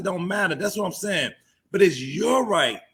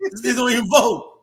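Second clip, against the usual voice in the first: mock scoff; 7 semitones higher, 6 dB louder than usual. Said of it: "It's like".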